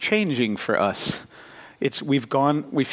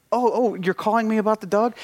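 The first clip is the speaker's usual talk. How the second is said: eager; 8 semitones higher than usual. Retold or imitated. Eager answer on phone.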